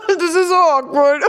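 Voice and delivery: embarrassed giggling words; laughing. Real, not acted. Awkward on camera with Matthew.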